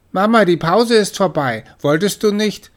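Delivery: high, loud call to mama; impatient kitten. Acted. Kitten calling to her mother that the break is over. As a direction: remind mother it's time.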